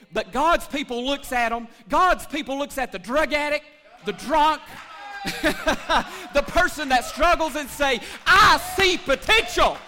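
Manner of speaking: shouting hope over the congregation; preaching at full force. Acted. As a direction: build it to a roaring pulpit peak.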